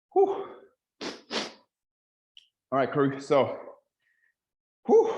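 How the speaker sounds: breathless, panting between words; out of breath. Real, not acted. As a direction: talk while catching your breath. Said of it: "let me catch my breath back".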